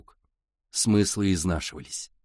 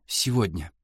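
tearful whisper, voice dropped low; whispering through tears; 4 semitones lower than usual. A narrator's reading. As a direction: whisper it while crying. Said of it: "прошептала сквозь слёзы девчонка".

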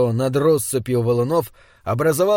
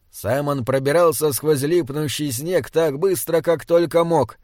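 ordinary delivery

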